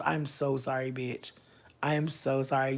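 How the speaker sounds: usual speaking voice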